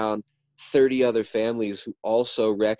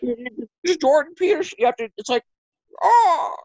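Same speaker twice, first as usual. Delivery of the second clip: high-pitched halting caricature, ending higher still; Jordan Peterson impression; 12 semitones higher, 3 dB louder than usual. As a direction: try out the impersonated voice, far above your own, breaking off in a loud high "oh". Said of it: "is that your Jordan Peterson impression?".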